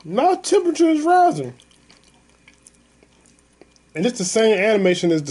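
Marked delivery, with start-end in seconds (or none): 0.0-1.5 high-pitched worked-up complaint; heated. Real, not acted. Man still fuming over the bus driver, heated. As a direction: let the voice climb high as you fume. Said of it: "I got angry at the bus driver".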